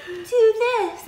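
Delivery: tiny, high, loud squeak of an angel; little cartoon angel. Cartoon angel on the shoulder urging him, high-pitched. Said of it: "a little angel pop up".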